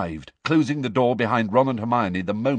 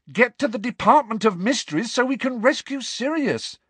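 high, insistent raised voice; loud; 13 semitones higher, 3 dB louder than usual. A narrator's reading. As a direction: say it loudly, impatient and urgent. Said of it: "Harry said loudly".

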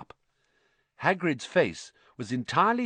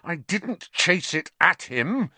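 shaking with anger; 8 semitones higher, slower than usual. A narrator's reading. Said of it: "his voice shaking with anger".

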